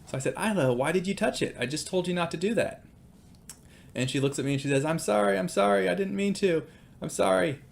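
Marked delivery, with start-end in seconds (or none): 0.4-2.8 higher-pitched, mildly exasperated scolding; retold frustration. Dad scolding daughter for touching the grill, retold frustration. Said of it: "I'm kinda frustrated". 4.8-7.6 high-pitched imitation of an upset child; retold tearful apology. Daughter apologizing to dad after the burn, imitated upset pleading. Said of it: "screams and cries and says".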